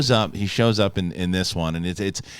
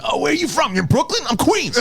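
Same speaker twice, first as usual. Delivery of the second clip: excited New Yorker; 12 semitones higher than usual. Retold or imitated. New Yorkers meeting in California.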